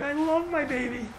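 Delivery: light mock father, soft and high; a hint of a cooing dad; father's voice. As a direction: play the doting father lightly.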